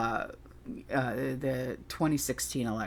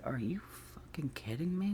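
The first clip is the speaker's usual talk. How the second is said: hushed disbelieving whisper; whispered are you kidding me; retold whisper; 10 dB quieter than usual. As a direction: breathe it out in shock. Retold outrage at friend's remark.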